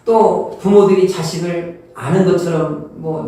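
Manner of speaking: ordinary delivery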